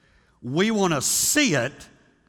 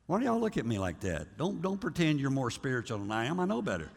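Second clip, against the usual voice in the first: teasing aside; 4 semitones lower, 8 dB quieter, faster than usual. Real, not acted. Joking aside to congregation.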